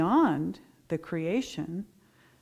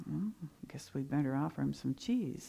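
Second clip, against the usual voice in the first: hushed aside, barely voiced; whispered thought; 3 semitones lower, 7 dB quieter than usual. Milkmaids thinking it over between themselves, whispered.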